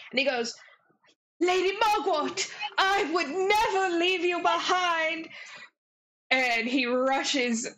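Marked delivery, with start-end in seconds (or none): 1.4-5.3 earnest fairy prince. Acted. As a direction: swear loyalty like a devoted young knight. GM voices the fairy dragon prince.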